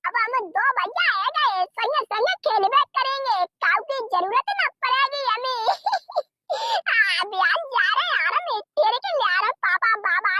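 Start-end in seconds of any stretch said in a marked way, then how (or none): 5.7-6.2 a high, gleeful giggle; a child's high laugh. Acted.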